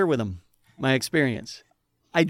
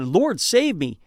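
urgent cry for help, raised; 6 semitones higher, 5 dB louder, slower than usual. Retold or imitated. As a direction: call out desperately to the Lord. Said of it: "crying out to God".

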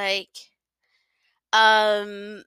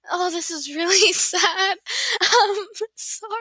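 higher, giggling through the apology; real embarrassed laughter; 10 semitones higher than usual. Real, not acted. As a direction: laugh helplessly at your own mistake. Embarrassed at Boston mistake.

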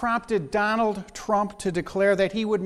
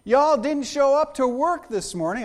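mock southern accent; 4 semitones higher, 3 dB louder than usual. Senator scolding absent male colleagues, mock Southern accent. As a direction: play a Southern lady chiding the gentlemen. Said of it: "where she got the southern accent".

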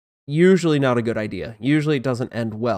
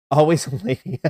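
laughing correction. Real, not acted.

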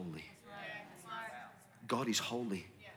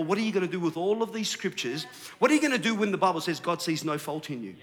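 normal talking voice

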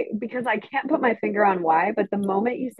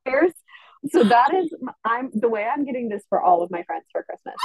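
ordinary delivery

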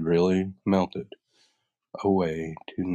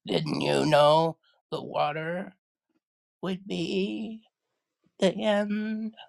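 high wail climbing higher, halting; wailing, despairing witch; 14 semitones higher, slower than usual. Acted. The witch asks Dorothy about the water, despairing wail. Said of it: "in a wailing, despairing voice".

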